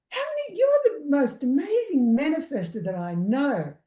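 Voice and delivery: jumps up high, then eager and bright; mock enthusiastic praise. Healer praising the client as an amazing manifester, enthusiastic. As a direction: pump her up with big praise.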